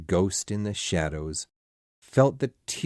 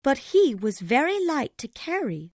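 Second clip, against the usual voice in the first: high-pitched mother's voice, remembering fondly; high character voice of a grieving mother; tender mother; 4 dB louder than usual. A narrator's reading. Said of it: "said Missus Cratchit softly".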